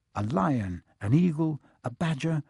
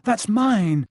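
protesting; 7 semitones higher, 6 dB louder than usual. Acted. Harry protests.

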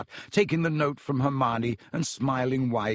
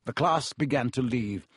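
ordinary delivery

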